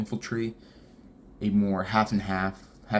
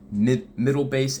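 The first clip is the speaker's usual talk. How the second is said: ordinary delivery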